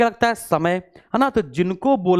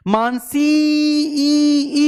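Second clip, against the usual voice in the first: Mansi ई with the ई held long, high and loud; drawn-out call; 8 semitones higher, 4 dB louder, much slower than usual. Shown as a demonstration. Teacher calling a student's name to show vowel lengthening, stretched high call. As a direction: call out to Mansi, dragging the final ई. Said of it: "ई को खींच रहे हैं".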